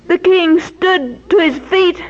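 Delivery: usual speaking voice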